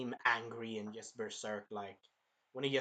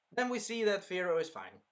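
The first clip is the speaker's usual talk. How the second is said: ordinary talk, nothing marked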